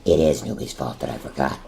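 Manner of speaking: ordinary talk, nothing marked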